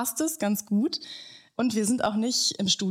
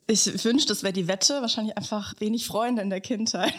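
ordinary delivery

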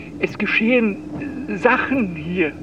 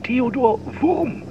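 ordinary talk

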